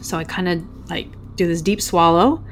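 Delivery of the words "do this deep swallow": usual speaking voice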